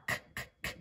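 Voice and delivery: "K, k, k." as soft voiceless clicks; letter sound K. Shown as a demonstration. Teacher sounding out car, separate soft k sounds. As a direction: repeat a bare, unvoiced "k". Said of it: "clever cat sound".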